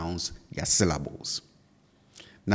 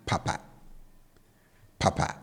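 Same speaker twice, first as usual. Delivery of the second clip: "papa" with both syllables kept low; 3 semitones lower, 4 dB quieter than usual. Shown as a demonstration. Teacher showing learners the second Twi word, low-low tone on "papa". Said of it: "I bring the tone on both syllables down".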